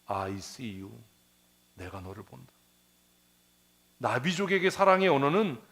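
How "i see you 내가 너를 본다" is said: tender quote. Acted. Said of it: "사랑을 어떻게 표현해요".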